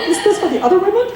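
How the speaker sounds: high, fast laughing disbelief; laughing incredulous. Retold or imitated. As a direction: laugh it off in mock surprise.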